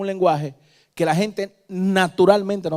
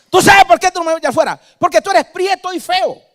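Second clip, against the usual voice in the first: mocking demon insult; 11 dB louder than usual. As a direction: jeer it like a cheeky demon. Demon insults preacher, laughter.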